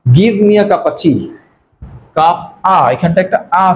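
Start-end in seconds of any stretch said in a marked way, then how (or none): 0.1-1.3 slow, "of" reduced to a schwa; blended of. Shown as a demonstration. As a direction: let the "of" vanish between cup and tea. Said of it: "অফটা কেন যেন অদৃশ্য হয়ে গেছে".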